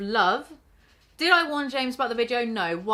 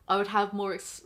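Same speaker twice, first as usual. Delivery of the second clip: ordinary talk, nothing marked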